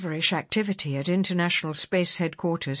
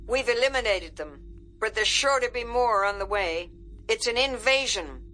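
higher, forceful military warning; urgent general; 6 semitones higher than usual. Acted. General warning the secretary of an invasion, urgent and forceful. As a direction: hammer out the threat as a commander.

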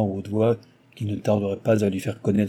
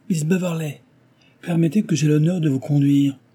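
calling out over the crowd, a little higher; cried out; 6 semitones higher, 4 dB louder than usual. A narrator's reading. Chevalier pushing through the crowd to Cecilia, calling out. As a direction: raise the voice to claim her arm.

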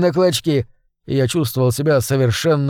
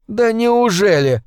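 hissed through teeth; 7 semitones higher, slower than usual. A narrator's reading. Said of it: "прошипел я сквозь сжатые зубы".

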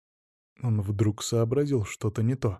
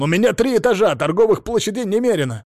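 yelling; 5 semitones higher, 6 dB louder than usual. A narrator's reading. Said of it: "заорал он".

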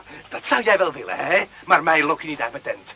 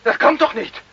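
higher, urgent pleading; desperate pleading; 6 semitones higher, 4 dB louder than usual. Acted. Carlo pleads with the lieutenant for his family, desperate. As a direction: beg the lieutenant to let you go. Carlo begs not to be arrested.

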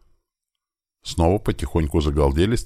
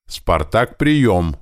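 higher, louder hail at the end; radio call; 6 semitones higher, 5 dB louder, much slower than usual. A narrator's reading. Commander calling silent Spartak, insistent raised hail. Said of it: "Снова стал я вызывать его".

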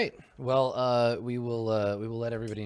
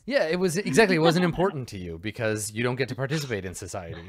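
loud scornful character; 4 semitones higher, 7 dB louder than usual. Acted. Player in character berating robot.

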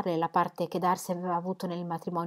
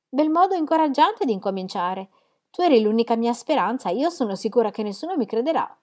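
higher, louder hurt protest; stung young woman; 4 semitones higher, 5 dB louder than usual. Acted. Elizabeth to doubting Jane, higher and louder, hurt. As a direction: reproach her sister for doubting.

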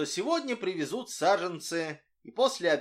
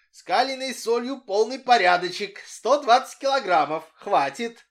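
higher and louder, bursting with joy; beaming excitement; 7 semitones higher, 9 dB louder than usual. A narrator's reading. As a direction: announce the good news, glowing. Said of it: "прибежал сияющий".

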